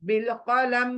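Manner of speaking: बिल कलम closed on a silent meem; stopped meem. Shown as a demonstration. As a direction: drop the final i of बिल कलम. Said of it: "मी को हटा दूंगी".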